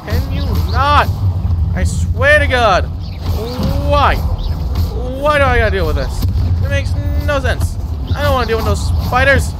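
fed up and letting the spiders know it; exasperated. Real, not acted. Whining at the spiders attacking her, exasperated.

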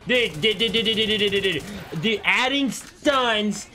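high, loud repeated dudes into rant; furious rant. Real, not acted. Gamer ranting at the stun effect, furious. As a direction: rattle off the complaint in anger.

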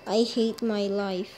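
ordinary delivery

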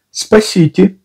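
high thin squeaky cry for help. A narrator's reading. Piglet calls for help in a squeaky voice. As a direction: squeak it out like a tiny trapped creature. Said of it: "тоненький песклявый голосок".

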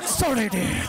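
high, drawn-out falling apology; climaxing apology. Acted. Englishman completing, apologises to his dear, high. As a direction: finish with a long, polite moan of sorry. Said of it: "that's me completing live on radio".

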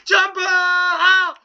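high, loud jump cry; excited shout. Real, not acted. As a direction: cry out as the car leaps.

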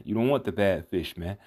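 usual speaking voice

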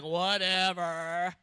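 long drawn-out, dismissive "whatever"; scornful whatever. Retold or imitated. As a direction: stretch it out slowly, mocking. Scoffers answer the fasting believer.

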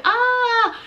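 high loud shriek; scream. A narrator's reading. Rita shrieks as the squirrel clings in her hair. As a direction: scream in terror.

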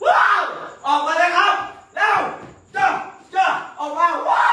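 loud taunting call to the spirits; mock dare shout. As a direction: shout a cocky dare at the ghosts. (ตะโกนท้าผีเสียงดัง)

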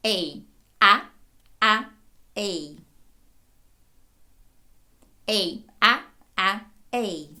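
drawn-out Ей against short А, each separate; warm-up drill, long Ей and short А; alternating vowel drill. Shown as a demonstration. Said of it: "поєднайте ці два звуки наступним чином". (вправа: протяжне «Ей», коротке «А»)

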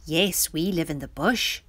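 ordinary delivery

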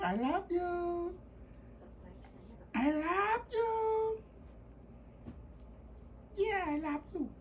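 high, soft coaxing coo. Real, not acted. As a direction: coax a puppy in a high voice. High soft baby talk.

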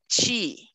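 "ti" pronounced "chee"; T softened to CH. Shown as a demonstration. Teacher introduces the Brazilian rule, "ti" said as "chee". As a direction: say "ti" with a CH as in cheese. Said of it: "vai ganhar a fonética".

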